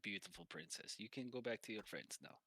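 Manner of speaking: put-on soft, soothing Russian-accented send-off; lightly voiced Russian masseur. Masseur sends princess back to friends, softly accented. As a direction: gently dismiss the client, hint of Russian accent. Game master plays the masseur.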